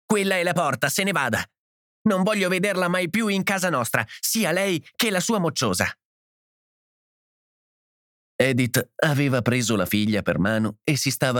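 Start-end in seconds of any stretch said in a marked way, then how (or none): 0.1-5.9 high-pitched, agitated tirade at normal volume; frenzied anger. A narrator's reading. Markey's wife banishing mother and child, frenzied anger. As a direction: order them out in a fury, pitch raised. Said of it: "gridò la Markey con frenesia".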